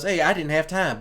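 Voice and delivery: ordinary talk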